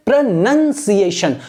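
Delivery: प्रोनंसिएशन slowly, syllable by syllable, with "nun" in the middle; teacher slowly modelling प्रोनंसिएशन in separate syllables; pronunciation demo. Shown as a demonstration. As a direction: stretch out each syllable of प्रोनंसिएशन, saying "nun", not "noun". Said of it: "उच्चारण को क्या बोलते हैं".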